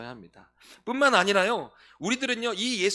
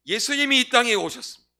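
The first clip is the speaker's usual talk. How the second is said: loud proclamation; 6 semitones higher, 12 dB louder than usual. Shown as a demonstration. Preacher proclaiming Jesus has come to the congregation, loud.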